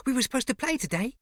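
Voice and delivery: put-on high small boy voice; young boy, eager and sweet; little boy voice. A narrator's reading.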